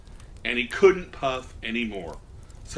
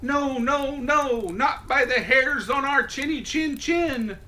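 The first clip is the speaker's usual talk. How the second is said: much higher little pig voices; 11 semitones higher than usual. A narrator's reading. Pigs refusing the wolf, very high-pitched. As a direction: squeal it back like tiny pigs. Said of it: "they answered back".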